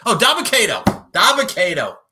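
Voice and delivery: loud, high burst of recognition; sudden recall. Real, not acted. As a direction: blurt out the name you finally found. Suddenly remembers the forgotten name.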